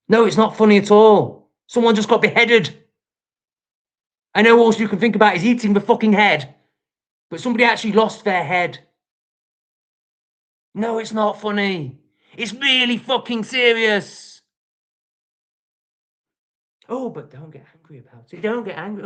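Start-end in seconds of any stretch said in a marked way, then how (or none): 0.1-5.4 louder furious insistence; angry shout. Real, not acted. Ranting at a commenter. 16.9-18.4 soft mock-soothing sarcasm; sarcastic mimicry. Mocking calls to stay calm. 18.4-19.0 high mock-soothing sarcasm; sarcastic mimicry. Mocking calls to stay calm.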